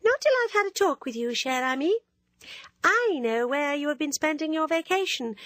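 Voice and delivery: a coquettish schoolgirl needling her friend; teasing Ginevra. Acted.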